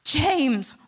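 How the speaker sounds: loud, sharp call of a name; angry shout. Retold or imitated. Mother scolding her son for forgetting tickets, loud angry shout. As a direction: bark his name to scold him.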